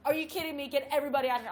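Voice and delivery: mock yell to clear the room; hypothetical rant at the coach, loud; angry outburst. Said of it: "being like".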